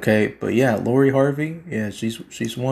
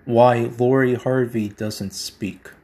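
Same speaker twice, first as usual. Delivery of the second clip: usual speaking voice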